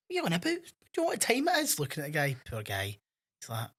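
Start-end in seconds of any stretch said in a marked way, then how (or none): 0.1-1.8 high-pitched mock indignant questioning; hammed-up high complaint; retold indignation. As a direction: play up a woken man's protest, pitched way up. Retold row, woken by delivery driver.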